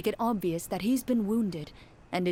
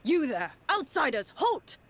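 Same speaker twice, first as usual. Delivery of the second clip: a rising barked order, climbing higher on halt; commanding call to halt; 7 semitones higher than usual. Acted. Guard captain halting sneaking outsiders, sharp rising command. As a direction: stop the strangers in their tracks.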